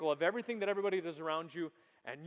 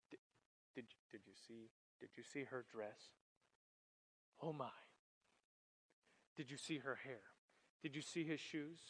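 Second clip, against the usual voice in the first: hushed, much softer gossiping whisper-talk; a much softer, low-voiced catty gossip; gossiping teen; 4 semitones lower, 14 dB quieter than usual. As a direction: lean in and dish the gossip quietly. Preacher acts out gossiping teens.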